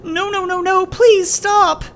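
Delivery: very high, loud and slow, breaking with panic; terrified pleading scream. Acted. Child begging father with the gun, screaming slowly. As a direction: drag out each no in terror.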